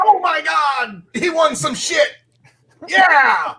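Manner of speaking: high, loud disbelieving cheer; excited shouting. Real, not acted. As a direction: shout it in delight. Cheering the winner.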